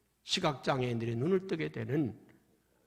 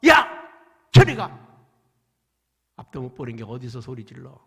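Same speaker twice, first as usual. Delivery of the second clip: loud, harsh dismissal; angry crowd; 4 semitones lower, 11 dB louder than usual. Acted. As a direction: shoo the beggar away. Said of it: "주변에 있는 사람들이". (맹인을 쫓아내는 군중의 거친 호통)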